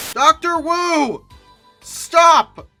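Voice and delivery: normal talking voice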